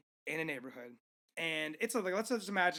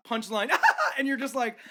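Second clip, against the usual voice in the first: ordinary talk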